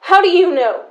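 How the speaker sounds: loud, rising fury at her sister; shouting at sister who claims to understand; angry outburst. Acted. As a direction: hurl it back at her, loud and bitter.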